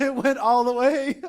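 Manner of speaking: laughing through the words. Real, not acted. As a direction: giggle out the line. Laughing at the joke.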